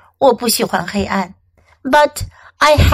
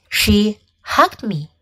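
ordinary delivery

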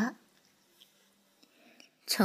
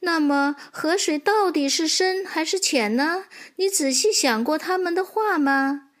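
higher, questioning motherly voice; mother horse; 6 semitones higher than usual. Acted. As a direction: prompt your foal to think. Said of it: "妈妈说". (马妈妈提高音调耐心追问)

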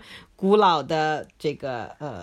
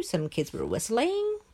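usual speaking voice

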